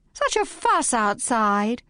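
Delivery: high, slow, fluttery complaint; complaining feminine voice. A narrator's reading. Housemate arriving and fussing about the police, high complaint. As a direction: fuss about the commotion outside. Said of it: "complained the voice".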